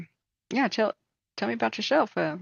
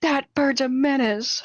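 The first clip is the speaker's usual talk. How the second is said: higher, louder, slow outraged shout; angry painter shout; 7 semitones higher, 4 dB louder, much slower than usual. Retold or imitated. Painter storms in, furious, higher and louder. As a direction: bellow that the bird is a menace. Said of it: "angry, furious person".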